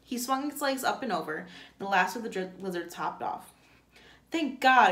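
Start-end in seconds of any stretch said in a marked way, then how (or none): 4.3-4.9 loud, high relieved outburst; exclaiming. A narrator's reading. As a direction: burst out with relief. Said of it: "exclaimed the warden".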